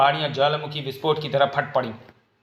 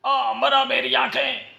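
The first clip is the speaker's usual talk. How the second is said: high, slow pained cry; 11 semitones higher, slower than usual. Acted. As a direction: wail as if struck in the eyes. Hit in the eyes.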